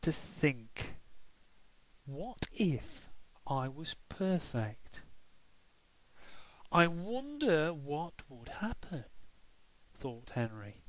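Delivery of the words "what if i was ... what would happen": put-on thoughtful child. Henry thinking aloud.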